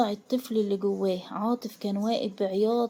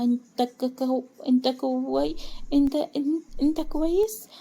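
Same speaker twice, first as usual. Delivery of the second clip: broken stammer, climbing higher; fearful, halting; 4 semitones higher, 3 dB louder, slower than usual. A narrator's reading. Frightened wife checking on angry husband, fearfully halting. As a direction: stumble slowly, pitch rising into the question. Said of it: "ملاك بخوفه وبتقطع".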